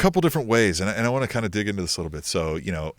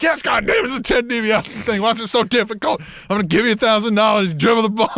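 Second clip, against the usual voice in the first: exasperated yell; 10 semitones higher than usual. Retold or imitated. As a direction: bellow it like he's lost all patience. Exasperated guy yelling at wrestler.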